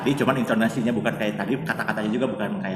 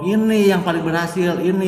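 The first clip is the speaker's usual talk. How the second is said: imitated bragging, higher and louder; boastful; 7 semitones higher, 6 dB louder than usual. Bragging to classmates that this one worked, boastful. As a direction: show off proudly, voice lifted. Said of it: "niatnya itu kan mau sombong ke teman-teman".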